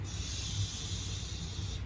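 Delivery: hushing shh. Real, not acted. Teacher hushing children.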